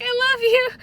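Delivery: high, loud, nearly crying with joy; a squealed declaration of love; overjoyed squeal. Retold or imitated. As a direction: gush it at your best friend. Happy reunion with friend.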